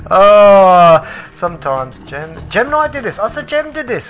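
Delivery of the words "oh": high, loud squeal through laughter; helpless laughter. Real, not acted. Player spots an arrow in someone's head, helpless laughter. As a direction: crack up while pointing it out, voice shooting up.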